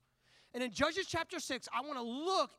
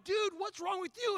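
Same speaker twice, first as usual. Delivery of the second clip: higher-pitched crowd reproach; indignant challenge; 8 semitones higher than usual. Retold or imitated. Onlookers scolding the man with coffee, indignant raised voice. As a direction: call him out in disbelief.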